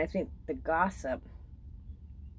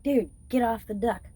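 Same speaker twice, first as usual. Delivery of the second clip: shooing the dog; 6 semitones higher, 4 dB louder than usual. Retold or imitated.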